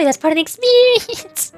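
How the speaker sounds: high-pitched, stretched-out sales pitch; mock enthusiasm. Streamer mimicking fans who praise suffering, mock enthusiasm. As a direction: push it up high like a gushing fan.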